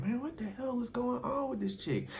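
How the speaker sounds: high, hushed mock disbelief; bewildered. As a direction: act baffled in a high, quiet squeal. Retold bewildered reaction to her.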